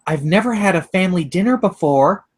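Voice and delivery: high-pitched, eager confession; excited. A narrator's reading. Boy delighted by a dinner invitation, excited. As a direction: let excitement lift the whole line. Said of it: "gasped excitedly".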